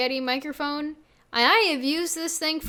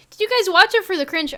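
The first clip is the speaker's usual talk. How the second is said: loud teasing; 7 semitones higher, 9 dB louder, faster than usual. Real, not acted. Teasing viewers about cringe.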